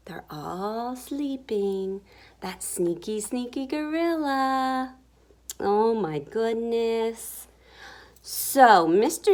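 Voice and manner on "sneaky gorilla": plain reading voice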